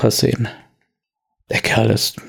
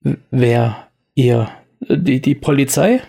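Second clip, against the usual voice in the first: stammelnd, etwas höher; er windet sich stotternd heraus; verängstigter Wirt. Acted. Wirt wird bedroht. (stotternder Wirt, etwas höher)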